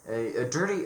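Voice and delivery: usual speaking voice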